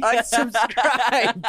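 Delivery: high, very slow laughing unsubscribed; drawn-out mock unsubscribe. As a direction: stretch it out high through laughter. Punchline of reading comments.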